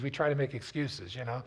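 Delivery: ordinary talk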